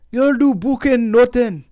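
high, exaggerated Irish fighter impression; Irish accent. Podcaster hamming up a fighter from a documentary, mock Irish accent. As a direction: put on a drawn-out, badly faked Irish accent. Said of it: "That's my Irish accent".

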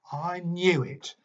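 the teacher sure he's right; triumphant exclamation. A narrator's reading. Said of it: "Marley exclaimed".

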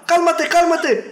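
high, loud mock Spanish pleading. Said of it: "Aí eu falei".

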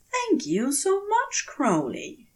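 a giddy, grateful Aziraphale; Aziraphale, delighted. Acted. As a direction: thank him with bright, beaming delight. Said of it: "he says".

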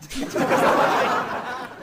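high breathy cackle. Real, not acted. Host laughing at a funny listener name, high cackle. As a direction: burst out in a squeaky laugh.

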